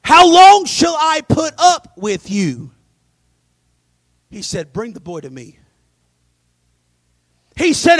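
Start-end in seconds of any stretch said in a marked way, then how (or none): 0.0-2.7 higher, slow exasperated scolding; exasperated rebuke. Acted. Jesus fed up with his disciples, exasperated. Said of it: "I could see Jesus getting mad". 4.7-5.6 softer, lower calm order; quiet command. Acted. Jesus calmly stepping in, quiet and firm.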